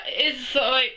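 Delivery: usual speaking voice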